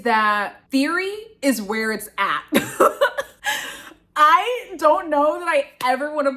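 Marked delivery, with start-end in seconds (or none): 2.5-3.9 high burst of laughter. Real, not acted. Laughing at own conclusion.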